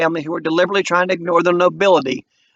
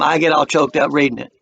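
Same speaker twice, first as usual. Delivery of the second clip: plain reading voice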